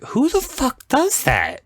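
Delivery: high-pitched comic disbelief; incredulous. Retold or imitated. Viewer reacting to an odd houseguest, incredulous. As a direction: squeak it out, appalled by the oddness. Retold outraged reaction to scene.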